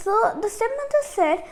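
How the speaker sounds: usual speaking voice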